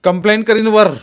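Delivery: loud, high-pitched threat; shouted threat. A narrator's reading. Jaydeep threatens to complain to superiors, shouting. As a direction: threaten him at the top of your voice. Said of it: "Jaydeep ओरडला".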